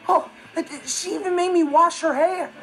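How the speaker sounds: loud, high-rising disgusted griping; aggrieved complaint. Acted. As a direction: gripe loudly, appalled by the chores. Complaining about humiliating chores.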